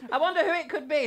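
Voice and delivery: ordinary talk, nothing marked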